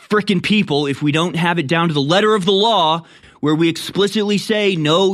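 escalating comedy rant. Retold or imitated. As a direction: scold them like psychopaths. Rant continues, Florida voiced.

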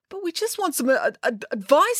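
high, timid stuttering plea; small, faltering high voice; stammer. A narrator's reading. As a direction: plead haltingly, stuck on the word. Joe stammers, pleading.

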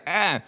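put-on high, loud little yelp of a falling toddler; mock toddler cry; imitated toddler yelp. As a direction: squeal it like a two-year-old knocked over. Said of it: "He's like".

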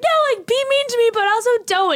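mock whiny plea pitched high up. Girlfriend mock begging her boyfriend, high whiny plea. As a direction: play it as a dramatic girlfriend begging. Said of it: "And I'm like".

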